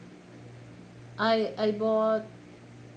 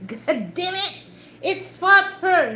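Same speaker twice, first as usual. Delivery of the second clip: raised mock outburst, loud at the end; upset dad; 8 semitones higher, 6 dB louder than usual. Dad arguing with his daughter, mock upset insistence. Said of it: "when they get upset".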